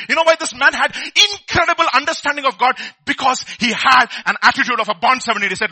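usual speaking voice

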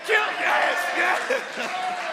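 mock-cheer her on, dripping sarcasm; sarcastic yelled encouragement. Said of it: "I was sarcastic".

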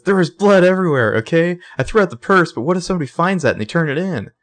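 hammed-up panic of a frightened friend; panicked Spencer. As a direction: voice her rising panic, breathless and high. Spencer panicking, voiced in recap.

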